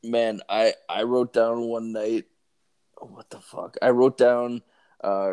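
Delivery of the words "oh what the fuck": whispered to himself, much softer; muttered whisper. Real, not acted. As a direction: whisper it like you lost your train of thought. Forgets what he wrote, muttered.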